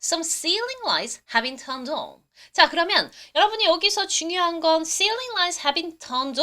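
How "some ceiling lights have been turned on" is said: very slow, careful English; slow model sentence. Shown as a demonstration. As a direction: read the sentence slowly. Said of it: "뭐라고 하겠어요?".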